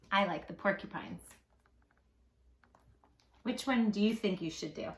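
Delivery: ordinary delivery, nothing marked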